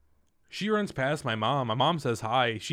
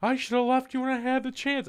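high-pitched imitation of her rant; upset girlfriend; 11 semitones higher than usual. Girlfriend ranting until he stops her, high mock voice. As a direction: fire off her regret in a high voice. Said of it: "I stopped her and went".

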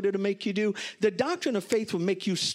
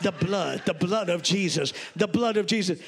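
put-on lower, repeated pleading of old church mothers; church mothers praying; 3 semitones lower than usual. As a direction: imitate the mothers' insistent, low intercession. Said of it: "you could hear the mothers".

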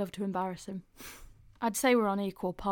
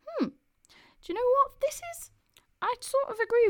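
much higher, squeaky surprised voice; 5 dB louder than usual. Real, not acted. Host surprised the horoscope fits her, squeaking high. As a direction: pitch right up in surprise.

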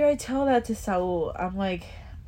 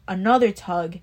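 normal talking voice

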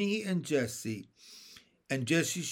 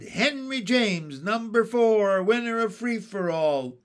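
announcer's proclamation; 7 semitones higher, 7 dB louder than usual. A narrator's reading. Announcing the race winner to the crowd, a loud proclamation.

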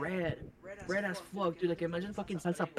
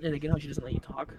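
ordinary delivery

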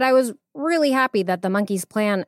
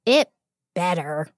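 understated, lowered disapproval; frowning answer. A narrator's reading. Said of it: "Viper answered with a frown".

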